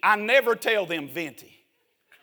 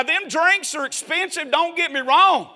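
ordinary talk

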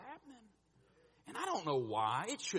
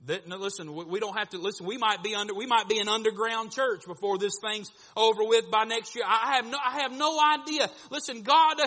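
louder, building preaching; 7 semitones higher, 11 dB louder than usual. Acted. Preacher warns the church, building to full force. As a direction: drive the point home to the congregation.

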